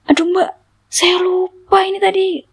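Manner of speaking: high-pitched flustered admission; dismayed confession. Retold or imitated. Driver confessing to passenger that he forgot, high and dismayed. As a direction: imitate a driver realizing his slip.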